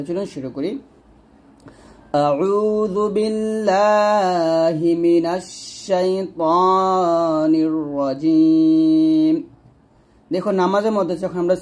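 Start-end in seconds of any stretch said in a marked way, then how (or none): 2.3-9.3 slow chanted tajweed recitation, long held vowels; Quran recitation. Shown as a demonstration. Teacher recites the phrase for students in slow tajweed. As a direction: intone it slowly like Quran reading.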